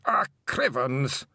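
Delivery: tired, muffled little voice; weary muffled pixie. Acted. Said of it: "weary and rather muffled voice".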